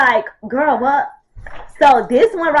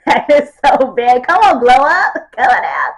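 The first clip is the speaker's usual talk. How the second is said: laughing cringe; 4 semitones higher, 6 dB louder than usual. Real, not acted. Reacting to old photo laughing.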